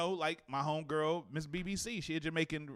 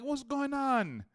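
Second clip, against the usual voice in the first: slow mock New York greeting; 7 semitones higher, much slower than usual. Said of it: "She like".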